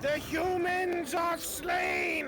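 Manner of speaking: high, slow triumphant rat-monster yell; rat creature shout. Acted. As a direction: bellow the victory like a rat beast. Rat monster cheers victory in character.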